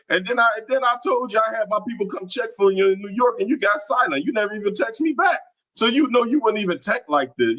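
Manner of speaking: high-pitched indignant rush; heated call-out. Real, not acted. Streamer confronting a troll who went silent, indignant.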